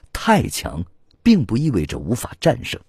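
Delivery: ordinary delivery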